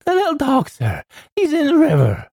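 very high, frantic wail; panicked housekeeper. A narrator's reading. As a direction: squeal the bad news in alarm. Panicked housekeeper reports emergency.

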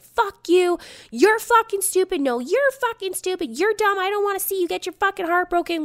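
hammed-up drunk fight; screaming match, retold; mock screaming. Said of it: "Jackie and I are screaming".

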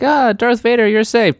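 high, relieved sigh of hope. Retold or imitated. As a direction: gush with mock relief. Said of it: "I was like".

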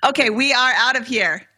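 ordinary talk

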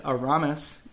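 "Aramis" said uh-RAH-mis; "Aramis" with weight on the second syllable; stress on RA. Shown as a demonstration. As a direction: punch the middle of "Aramis". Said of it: "putting the stress on the Ra".

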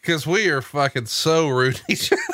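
ordinary talk, nothing marked

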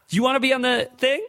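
high-pitched, singled-out question to a stranger; imitated crew member's offer. Camera guy asking a nobody behind him, high imitated offer. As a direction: pitch it way up, calling to someone behind.

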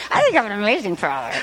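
low, rushed mock father; imitated self-satisfied dad, low and fast; Dean's dad. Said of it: "your imitation of, of Dean's dad".

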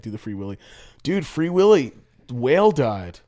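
ordinary delivery

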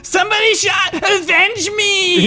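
high, laughing, shouting death cry; overacted death scene. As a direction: ham up a dying plea. Character shot, dying dramatically.

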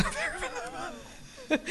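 very high, laughing and fragile; laughing squeak. Shown as a demonstration. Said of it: "from very together to very vulnerable".

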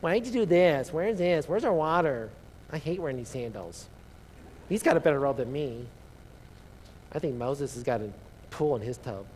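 mock nasal whine of grumbling wanderers; nasal whiny Israelites. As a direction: whine it like a spoiled complainer, lightly nasal. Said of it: "it's probably a little nasally".

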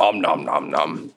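slow "om nom nom" munching; mock chomping. Game master as ghoul biting ranger, mock chomping. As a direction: play at gobbling down a bite.

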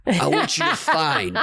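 mock mob boss demanding the votes. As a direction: lean in like a mobster leaning on someone. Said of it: "a mob boss going".

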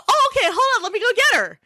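very high, perky helper voice; [name]. Retold or imitated. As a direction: cheerfully humour a little child. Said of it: "So I go".

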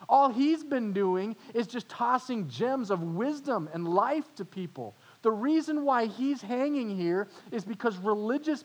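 high, loud heated rebuke of the other criminal; yelling at his buddy. Acted. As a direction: defend Jesus with rising heat. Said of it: "he yelled at his buddy".

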